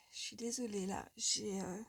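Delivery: fighting back happy tears; choked up. Real, not acted. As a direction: voice catching, tearful and quiet. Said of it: "Après mes pleurnicheries".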